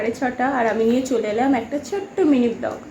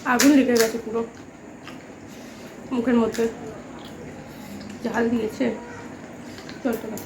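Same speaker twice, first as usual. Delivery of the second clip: talking through burning spice; gasping from chili heat; mouth burning from spicy food; 5 dB quieter than usual. Real, not acted. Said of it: "এখন তো আমার ভীষণ ঝাল লাগছে".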